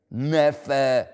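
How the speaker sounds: hard, loud single word; harsh Scrooge. A narrator's reading. Scrooge to his nephew, hard and curt. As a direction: cut him off coldly. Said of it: "erwiderte der Onkel hart".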